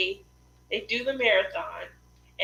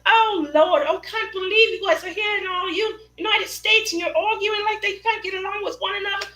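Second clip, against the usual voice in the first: high-pitched mock British scolding; attempted UK accent; 11 semitones higher, 6 dB louder than usual. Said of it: "what I thought was a U K accent".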